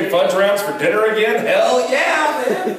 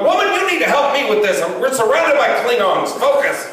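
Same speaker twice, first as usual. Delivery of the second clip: higher, urgent mock commander; hammed-up Star Trek captain under Klingon attack; mock starship captain; 8 semitones higher than usual. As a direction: bark orders like a cornered starship captain. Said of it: "And I'm like".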